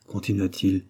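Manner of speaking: plain reading voice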